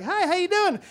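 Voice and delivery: put-on high-pitched chirpy old-lady greeting; singsong little old lady hello; friendly old lady. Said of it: "this old lady comes out of the building".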